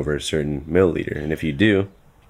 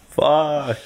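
ordinary delivery

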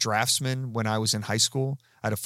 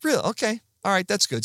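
higher, mock-cheerful sarcasm as if unbothered; sarcastic disbelief; 9 semitones higher, faster than usual. Retelling being fired, a mock-bright sarcastic reply. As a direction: pretend to be fine with being fired. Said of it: "And I'm like".

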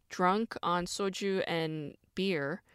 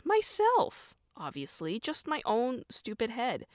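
much higher, punchy myself; emphatic self-answer; 6 semitones higher, slower than usual. Real, not acted. Naming herself as the source of guilt, high emphatic.

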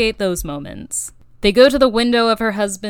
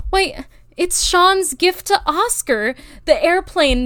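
mock surprise; 8 semitones higher than usual. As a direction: fake amazement at the banner plane. Mock gasp at the plane.

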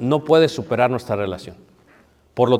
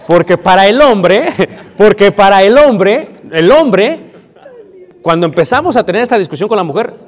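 louder, higher preaching with laughs breaking in; fired-up preaching; 6 semitones higher, 8 dB louder than usual. Acted. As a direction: preach it hard to the congregation. Predica a todo pulmón. (predicador a todo pulmón, entre risas)

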